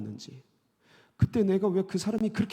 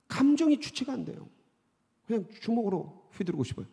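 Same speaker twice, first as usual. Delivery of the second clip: acted anguished prayer. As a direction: pour out the forbidden feelings to God. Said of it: "저 연기하는 겁니다". (괴로움을 토로하는 기도 연기)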